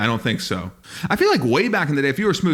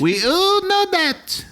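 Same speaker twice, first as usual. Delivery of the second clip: high, drawn-out mock European accent; put-on European accent; much slower than usual. European burglar agrees with cohost, mock European accent. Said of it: "we should get voiceover work".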